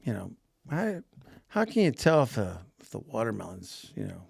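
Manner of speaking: soft, hesitant shopper's question; curious customer. Retold or imitated. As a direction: ask quietly like an unsure shopper. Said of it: "they'll be like".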